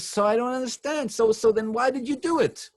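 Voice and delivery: very high-pitched, dismayed frog voice; bewildered pleading. Acted. Dying frog demands an explanation.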